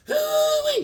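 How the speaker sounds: high, loud hoo-wee yelp; a cowboy-style hoo-wee of delight; hoo-wee whoop. Real, not acted. Comic book hype exclamation.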